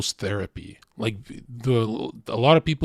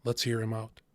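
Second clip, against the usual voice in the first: soft, lower calming voice; calm brother; 3 semitones lower, 7 dB quieter than usual. Retold or imitated. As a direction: settle everyone down gently. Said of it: "he'd be like this".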